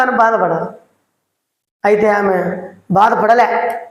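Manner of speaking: ordinary delivery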